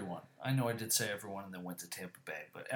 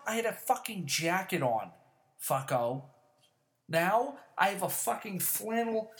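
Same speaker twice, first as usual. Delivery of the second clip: heated, higher-pitched venting; yelling rant; 7 semitones higher, 4 dB louder than usual. Real, not acted. Defending himself for feeling cold, yelling rant. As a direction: rant indignantly, punching the swear words. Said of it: "I'm just fucking yelling about it".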